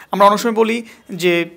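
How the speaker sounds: ordinary talk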